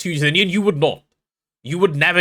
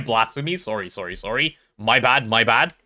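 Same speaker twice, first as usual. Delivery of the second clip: usual speaking voice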